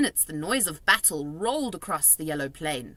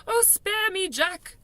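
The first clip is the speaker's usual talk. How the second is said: yelled plea; 11 semitones higher, slower than usual. A narrator's reading. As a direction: beg for mercy at a yell. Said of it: "he yelled".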